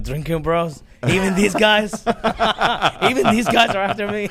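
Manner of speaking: high, loud, laughing disbelief; mock the Ayatollah, half laughing; Ayatollah impression. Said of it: "the Ayatollah is gonna go like".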